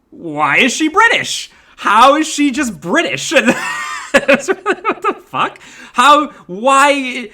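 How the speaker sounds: high, loud, incredulous outburst; laughing disbelief. Real, not acted. Reviewer baffled by a British character, incredulous and laughing.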